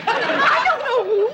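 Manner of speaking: high, slow, drawn-out frustration; high-pitched indignant protest; exasperated wail. Acted. Wife's accusation, pressed for details.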